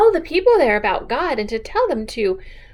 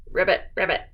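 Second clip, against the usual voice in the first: frog ribbit; 7 semitones lower, 5 dB quieter, faster than usual. Retold or imitated. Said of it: "Frogs can say".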